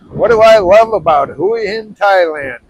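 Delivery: loud over the wind. Real, not acted. Announces topic over wind.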